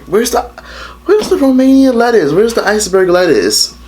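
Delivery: high, incredulous complaining; outraged where's the lettuce; indignant outrage. Real, not acted.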